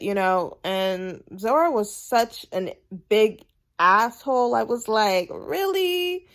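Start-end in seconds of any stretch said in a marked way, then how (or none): 5.3-6.3 "Really?" pitched high in disbelief; a high, disbelieving "Really?"; incredulous "Really?". Retold or imitated. Her own reaction to Zora.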